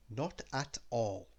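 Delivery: plain reading voice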